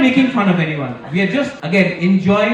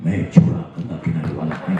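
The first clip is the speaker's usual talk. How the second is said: Amitabh Bachchan impression; much faster than usual. Mimicking Amitabh song line.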